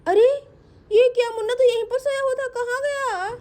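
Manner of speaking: high, startled mother; startled alarm. Acted. Mother finds Munna gone from her bed, startled high voice. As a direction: raise the pitch in sudden alarm.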